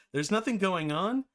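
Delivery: usual speaking voice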